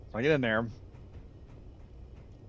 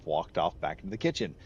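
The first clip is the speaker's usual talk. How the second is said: ordinary talk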